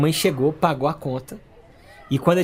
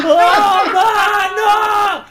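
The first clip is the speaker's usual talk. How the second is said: high loud yell of a friend's name; calling out; 12 semitones higher, 5 dB louder, much slower than usual. Retold or imitated. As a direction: shout for your buddy across the bar.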